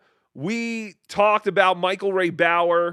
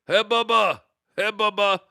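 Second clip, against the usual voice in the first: Iron Sheik impression; 4 semitones higher than usual. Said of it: "Iron Sheik used to call everybody Bubba".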